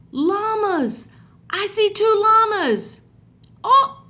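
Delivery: gasp, then high, loud delighted spotting; thrilled to find the llamas; excited discovery. Acted. Spotting animals in the picture.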